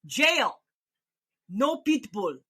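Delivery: stern Dutch police order; Dutch accent. Retold or imitated. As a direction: announce it like a foreign officer. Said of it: "And he says".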